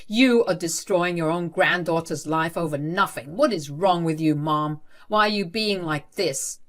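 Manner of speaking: loud, furious, demanding; raging. Acted. Husband raging at his mother, loud and furious. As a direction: rage at her with a hard, loud voice. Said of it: "Elliot was raging at his mom".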